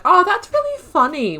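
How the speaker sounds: high, loud, hammed-up laughing reply; mock amused. He takes her jab as a joke, high and loud mock delight. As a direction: play him overdoing the delight at a joke. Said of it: "He was just like".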